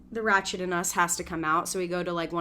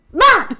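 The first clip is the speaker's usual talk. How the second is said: explosive high, loud bark; imitated outburst; 13 semitones higher, 14 dB louder than usual. Imitating the man turning to her, loud abrupt bark. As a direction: burst out with a short mock shout. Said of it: "he turns to me and goes".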